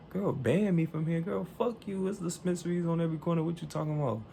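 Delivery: high-pitched, indignant retort; defiant comeback. Retold or imitated. Customer defying the clerk's threat to ban, indignant. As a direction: throw the threat right back, worked up. Acted quarrel over being banned.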